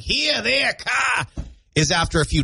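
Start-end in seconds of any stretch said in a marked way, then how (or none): 0.0-1.3 high-pitched, R-less Maine accent. Shown as a demonstration. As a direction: lay on a thick Maine accent. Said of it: "slip into that Maine accent".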